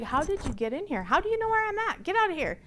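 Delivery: high-pitched, startled demand; startled, high and insistent; alarmed confrontation. Retold or imitated. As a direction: retell it as an indignant, startled protest, pitch jumping up. Retold startled confrontation with intruder.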